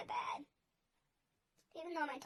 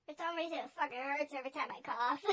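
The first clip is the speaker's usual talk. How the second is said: usual speaking voice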